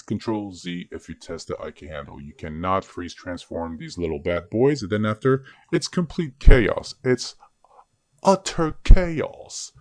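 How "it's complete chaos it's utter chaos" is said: loud, high, hammed-up British accent. Tutor warning students about freeze transforming, mock British accent. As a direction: declare it with a put-on British accent. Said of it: "That's my British accent".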